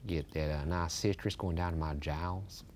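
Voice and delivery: low, slow Southern drawl; hammed-up Southern drawl, sinking to a murmur; Southern accent. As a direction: savor it in a soft Southern drawl. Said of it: "I'll do a Southern accent instead".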